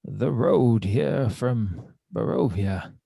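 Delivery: slow, measured in-character account; theatrical adventurer. Acted. Kane tells hunters of a dead wolf, slow and theatrical. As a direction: draw the words out with grand deliberation.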